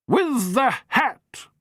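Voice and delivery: high, slow, triumphant grande dame; triumphant matron. Acted. Matron announces the thief to the Doctor, triumphant. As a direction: savour the reveal.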